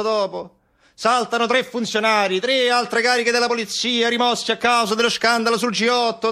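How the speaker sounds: voce molto più alta e caricata, da macchietta; voce da caricatura. Acted. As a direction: fai il verso a un poliziotto sbruffone. Mocking a police officer's voice. (macchietta acuta e beffarda)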